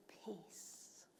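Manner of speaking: one soft whispered word. Acted. Preacher quoting Jesus to the congregation, whisper. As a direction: breathe the word out.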